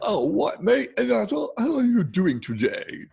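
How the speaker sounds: hammed-up English accent, high and loud, veering between posh and Cockney; mock an American's English accent, half Queen's English, half Cockney; exaggerated Queen's-English-meets-Cockney accent; bad English accent. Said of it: "his version of an English accent".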